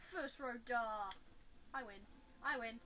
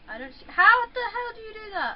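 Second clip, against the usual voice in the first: frustrated outcry; 6 semitones higher, 8 dB louder than usual. Real, not acted. Frustrated outburst at the game.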